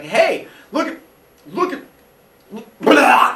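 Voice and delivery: high gagging voice ending in a loud retch; mock retching. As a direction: gag and heave on blah. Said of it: "he throws up everywhere".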